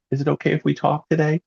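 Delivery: high, meek, apologetic ask; timid caller. Shown as a demonstration. Coach mocks a timid caller asking to talk, meek. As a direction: ask meekly for permission. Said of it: "not sounding like you're sneaking in".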